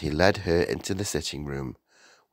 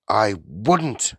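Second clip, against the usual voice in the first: irritated interruption near usual pitch; mildly louder, sour retort; ratty; 3 semitones higher, 5 dB louder than usual. A narrator's reading. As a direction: cut in crossly. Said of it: "She was still ratty".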